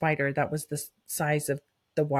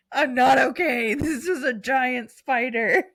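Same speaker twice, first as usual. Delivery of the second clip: high, slow, insistent mock distress; acted-out spider freak-out; re-enacted panic; 6 semitones higher, 3 dB louder, slower than usual. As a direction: draw it out in hammed-up alarm. Re-enacts her panicked reply to sister.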